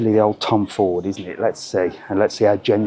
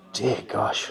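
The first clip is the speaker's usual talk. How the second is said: gasp, then breathe "Dear gosh" in a whisper; a shocked, voiceless gasp at the price; 11 dB quieter than usual. Real, not acted. Shocked at a whisky bottle's price, whispered gasp.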